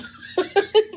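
high squealing laugh; burst of laughter. Real, not acted. Laughing at co-host's reaction.

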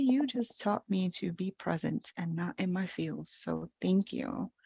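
tender thanks. Retold or imitated. Mother thanking her toddler.

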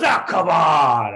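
loud, slow, drawn-out whine; heckler shout. Retold or imitated. Heckler whining back loud at the comic, slow shout. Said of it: "he goes".

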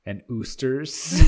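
oosters said slowly with "oo" for "oy"; joke pronunciation. Pretend. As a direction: stretch "oosters" through a suppressed laugh. Absurd variant of oyster.

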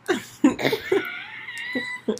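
high cackling laugh, rough and breathless; raspy smoker's laugh. Real, not acted. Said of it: "I literally sound like a chain smoker".

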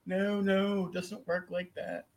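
high, soft retold no-no; exasperated refusal. Refusing crowd wanting whole series.

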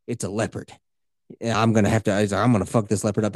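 low and cocky, a big cat hunter; tough hunter. Retold or imitated. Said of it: "doing accents".

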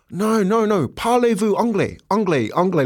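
high, repetitive tourist plea; insistent confusion. Retold or imitated. Tourist insists to French stranger, high mock-exasperated pleading. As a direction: press the question as if not understood.

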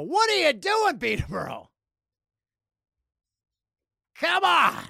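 high, loud comic exasperation; mock scolding. Host scolds hometown for ranking sixteenth, mock outrage. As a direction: rib them with an exasperated yell.

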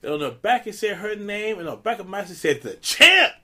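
high-pitched retold brag, building to a punch; mock boasting. Comic retelling of a dad bragging to card players, boastful. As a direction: play up the dad's showing off.

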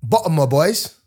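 sample of fake London accent; amateur put-on London accent; London accent attempt. Shown as a demonstration. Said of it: "How do they talk in London?".

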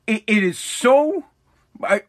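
usual speaking voice

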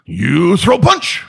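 loud, slow, high command in a put-on Korean accent; Jihan Jay. Grandmaster challenging a seminar student, shouted Korean-accented order. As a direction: bark it in Korean-accented broken English. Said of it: "and he goes".

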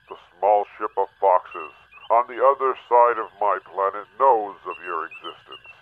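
normal talking voice

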